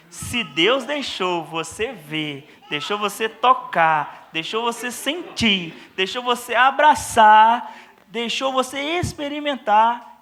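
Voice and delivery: slow, rising to a loud preacher's climax; mock preacher build. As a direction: ham up a stage preacher building each line.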